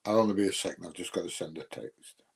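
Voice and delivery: ordinary talk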